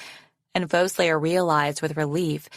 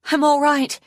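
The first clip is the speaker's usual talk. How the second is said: high, loud reassuring shout; 10 semitones higher, 6 dB louder than usual. A narrator's reading. Foeslayer reassuring Arctic mid-flight, shouting. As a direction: shout it high across the distance. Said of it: "she shouted".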